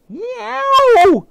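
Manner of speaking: high loud yelp of pain; sudden high yowl. Acted. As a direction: yelp as if bitten. Dog bitten on the neck.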